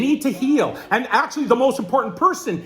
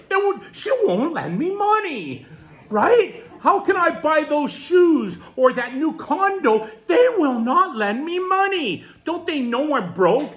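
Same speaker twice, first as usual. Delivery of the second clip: higher, whining, mocking the complainer; mock whiny complaint; 7 semitones higher than usual. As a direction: whine like a broke adult child. Mocking whiny complainers about mom.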